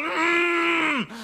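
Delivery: a muffled high cry through closed lips; closed-mouth yelp of alarm; high panicked mumble. Retold or imitated. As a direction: squeal through a full mouth. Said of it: "and I'm going".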